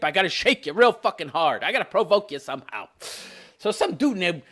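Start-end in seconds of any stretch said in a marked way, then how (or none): none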